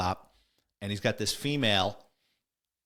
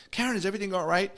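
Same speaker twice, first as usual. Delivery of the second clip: retold worried call; 8 semitones higher, much faster than usual. Boyfriend bursts in, calls to Karen.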